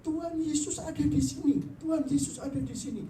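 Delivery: high, loud joyful cry; shouting. Retold or imitated. Sick husband wakes at night, shouting high and loud. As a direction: cry out that Jesus is here. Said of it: "dia teriak".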